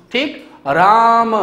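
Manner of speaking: राम said slowly and drawn out; teacher demonstrating राम slowly; drawn-out Ram. Shown as a demonstration. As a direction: stretch राम out so each sound is heard. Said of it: "मैंने बोला राम".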